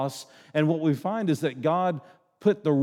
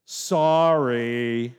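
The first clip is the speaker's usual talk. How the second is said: put-on mumbled, grudging child's sorry; reluctant five-year-old apology; sulky child's sorry; 4 semitones lower, 3 dB louder than usual. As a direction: drag the word out like a pouting kid. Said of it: "what did it sound like when they repented".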